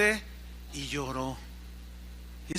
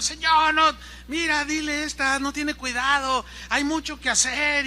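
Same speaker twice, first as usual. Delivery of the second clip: queja de Marta; 7 semitones higher than usual. Acted.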